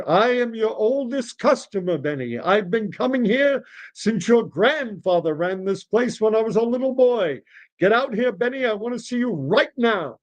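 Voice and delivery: put-on high, indignant old regular; demanding old customer. Oldest customer pulling rank on deli owner, demanding. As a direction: insist in a high, swooping voice. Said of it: "old Mister Rabinovich says".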